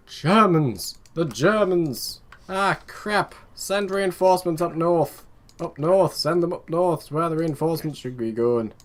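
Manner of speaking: high, loud mock Irish-Northern accent; put-on Irish-Northern accent. Commander sending reinforcements north against the Germans, hammed-up Irish-Northern accent. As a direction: cry the alarm in a wobbly Northern-cum-Irish accent. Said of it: "Why am I doing this accent again?".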